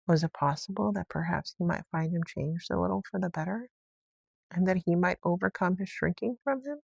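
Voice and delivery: ordinary delivery, nothing marked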